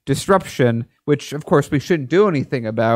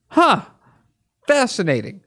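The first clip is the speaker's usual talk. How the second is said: high mock surprise; sarcastic fascination; 7 semitones higher than usual. Real, not acted. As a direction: feign interest, dripping sarcasm. Sarcastic feigned interest.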